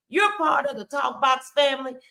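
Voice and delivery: normal talking voice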